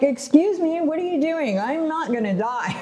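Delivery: high, indignant outburst at the priest; high-pitched disbelieving protest, mock outraged; retelling the last rites to the priest, high and indignant; offended outrage. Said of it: "like, mad".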